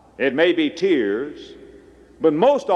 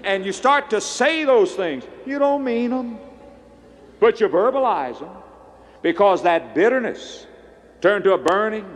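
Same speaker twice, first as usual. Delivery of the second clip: higher, climbing preacher's chain of steps; preaching at full force; 7 semitones higher than usual. Acted. Pastor warns the congregation, rising into a fervent preaching build. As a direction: drive the chain up like a revival sermon.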